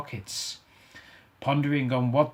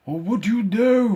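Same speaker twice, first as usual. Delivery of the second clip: mournful ghost; 10 semitones higher, 7 dB louder than usual. Acted. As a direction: grieve for the wasted life. Ghost questioning.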